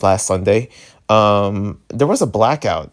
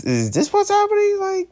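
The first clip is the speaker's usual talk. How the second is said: squeaky falsetto disbelief, much higher; can't believe what he's seeing, voice leaps up; shocked disbelief. Real, not acted. Vlogger reacting to the naked-dudes music video, shocked falsetto.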